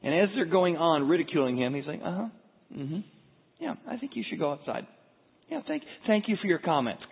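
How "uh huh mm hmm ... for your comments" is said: polite dismissal. Acted. Jesus politely brushing off the mockers.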